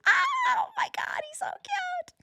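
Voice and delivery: high squealing whisper of delight; gushing over cat. Retold or imitated. Cat owner spotting cat beside the child, squealing coo. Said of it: "then I'm like".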